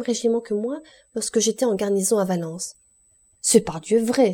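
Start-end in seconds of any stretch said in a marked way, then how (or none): 3.4-4.3 louder, higher, delighted cry; joyful exclamation. A narrator's reading. Shipowner hears the emperor knew his family, joyful exclamation. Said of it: "s'écria l'armateur tout joyeux".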